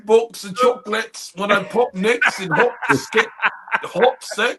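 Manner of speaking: high, silly British rap parody; mock British drill rapper. Comedian parodying British drill to make his friend laugh, mock British accent. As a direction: chant nonsense in fake British drill style. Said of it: "soon as dude rap".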